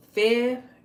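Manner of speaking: February sung out high and loud. Shown as a demonstration. Demoing the word to viewers, loud and high. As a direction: belt out February with every syllable. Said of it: "That's how you say it".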